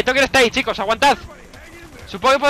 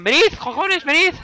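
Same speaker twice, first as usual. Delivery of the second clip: frustrated yell; 9 semitones higher, slower than usual. Real, not acted. Gamer yelling at his AI crew to come, exasperated shout. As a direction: yell for the team to get over here.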